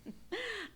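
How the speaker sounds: short, high, breathy scoff of a laugh; a dry little laugh at his expense; brief derisive chuckle, quiet; scornful laugh. Acted. Wife scoffs at the brother-in-law.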